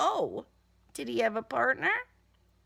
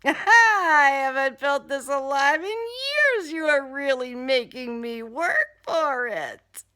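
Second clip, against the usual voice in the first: gleeful killer; 3 semitones higher, 7 dB louder than usual. Acted. Killer Mayhem thrilled by the chase, laughing loud. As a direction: savour the hunt with a manic laugh. Killer taunts hunted narrator.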